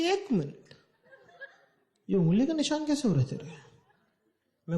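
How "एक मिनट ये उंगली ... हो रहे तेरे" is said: higher, sharp suspicious mother; mother noticing finger marks on his cheek, suspicious and higher. Retold or imitated. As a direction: catch him out with a raised voice.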